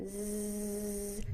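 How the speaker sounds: long voiced buzzing z; isolated z buzz, voice on; buzzing z sound. Shown as a demonstration. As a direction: hold a steady buzzing z on its own. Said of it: "similarly to the Z".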